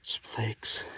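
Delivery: whispered to himself. Real, not acted. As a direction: breathe it out unvoiced, just for yourself. Muttered to himself on hillside.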